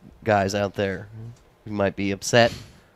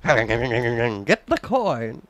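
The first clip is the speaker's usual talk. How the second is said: drawn out game voice; much slower than usual. Retold or imitated. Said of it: "you always had to go like this".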